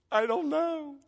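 mock crying wife, very high pitch; high, whiny pretend sob; tearful wail. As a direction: imitate a weepy, helpless wail. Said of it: "I look over, and she's crying".

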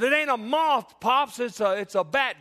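high, loud mock-frantic protest; protesting scared kid. Scared boy arguing with grandpa, acted high loud protest. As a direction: insist urgently as a frightened child. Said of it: "I said".

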